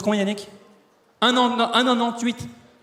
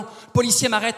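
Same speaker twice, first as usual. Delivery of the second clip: ordinary delivery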